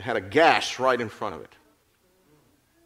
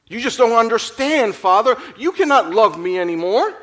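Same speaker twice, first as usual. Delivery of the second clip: distraught plea; 9 semitones higher, 8 dB louder than usual. A narrator's reading. Distraught child confesses to father.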